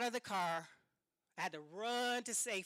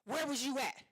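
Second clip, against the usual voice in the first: angry husband demand; 4 semitones higher, 6 dB louder than usual. Retold or imitated. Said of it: "He was like".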